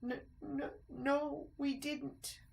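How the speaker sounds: high nervous stammer. A narrator's reading. Mice admitting to the rat, nervous stammer. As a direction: stammer out the truth.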